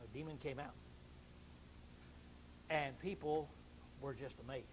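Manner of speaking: quietly awed narration; hushed. Acted. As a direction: drop to a hush for the miracle. Hushed dramatic telling.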